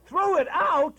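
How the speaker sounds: high, loud incredulous cry; outraged disbelief. Acted. Husband learns his wife threw out his hat, shouting in disbelief. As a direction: shout it back in shock.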